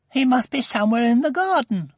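put-on high-pitched child insisting; high, anxious child's voice; worried little girl. A narrator's reading. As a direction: voice a girl clinging to hope. Girl insists lost pet is near.

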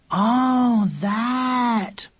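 high, drawn-out realisation; child suddenly getting it, dismissively; long high "oh" of recognition; dawning recognition. Acted. Younger brother finally recognises the animal.

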